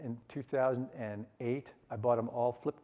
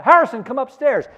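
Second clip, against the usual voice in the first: imitated wife yelling, loud and high; 10 semitones higher, 16 dB louder than usual. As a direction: call sharply up through the house, imitating a mother. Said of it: "My wife would yell down".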